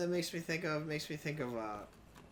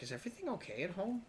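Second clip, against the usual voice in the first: concerned voice; 6 semitones higher, 4 dB quieter, much faster than usual. Retold or imitated. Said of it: "And the one guy's like".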